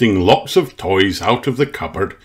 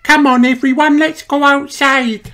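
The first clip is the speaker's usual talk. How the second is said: very high little mouse voice; Mandy. A narrator's reading. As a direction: call friends along in a tiny, squeaky-high voice. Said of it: "says Mandy".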